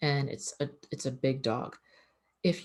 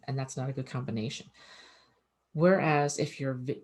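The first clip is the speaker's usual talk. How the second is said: ordinary delivery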